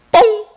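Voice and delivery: very high, abrupt imitated pop; one quick, concise mock toot; short fart noise. Gyeongsang woman farting beside her man, short and concise. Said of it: "아주 짧고 간결하게".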